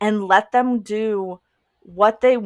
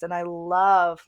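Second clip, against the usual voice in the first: ordinary talk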